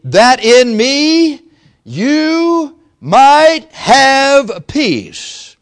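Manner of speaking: drawn-out scripture quote. Retold or imitated. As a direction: stretch every word like a proclamation. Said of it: "He said, that's what he said".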